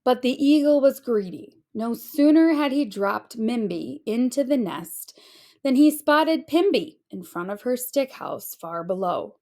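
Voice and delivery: plain reading voice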